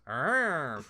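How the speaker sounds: one drawn-out playful "arr"; tiger-like monster growl. Retold or imitated. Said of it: "a tiger noise at her".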